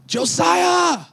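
high, loud shout of a name; calling out. Retold or imitated. Broker calling Josiah on the phone at dawn, loud shout. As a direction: call his name across the phone.

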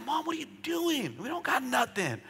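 put-on a boy questioning his mom; child asking mom why she gives; young son pleading. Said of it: "I'd be like".

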